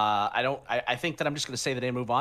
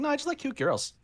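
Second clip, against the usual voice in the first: high mock voice; 13 semitones higher than usual. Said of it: "He's like".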